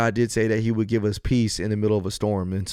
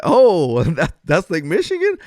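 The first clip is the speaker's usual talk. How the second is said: mock-astonished, higher-pitched reaction; retold surprise; 6 semitones higher, 6 dB louder, slower than usual. Traveler learning from a stranger it's Lake Michigan, surprised. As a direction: play up the disbelief, loud high "Oh". Acts out his surprise at correction.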